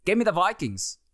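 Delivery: high-pitched, wandering mock British accent; muddled put-on accent. Host picking the Vikings in London, accent gone astray. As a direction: slip from British into some unknown accent. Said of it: "I don't know what accent I've gone into".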